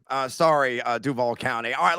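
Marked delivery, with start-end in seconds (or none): none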